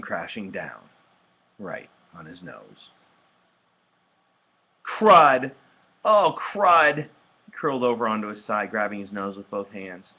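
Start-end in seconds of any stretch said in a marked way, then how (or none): 4.8-7.1 loud, high outburst of pain; pained yell. A narrator's reading. Boy hit on the nose, yelling in pain. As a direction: cry out as if just struck.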